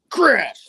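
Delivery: loud, drawn-out crash effect; imitated crash. Narrator acting out a slam, imitated crash. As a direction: shout the sound effect, stretched out.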